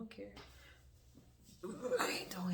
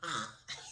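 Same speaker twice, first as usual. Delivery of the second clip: demo laugh; 9 semitones lower than usual. Retold or imitated. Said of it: "like that".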